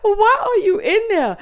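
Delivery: high, loud disbelief after laughing; loud, high-pitched amused protest; incredulous outburst. Real, not acted. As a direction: exclaim it, still tickled. Still cracking up, incredulous.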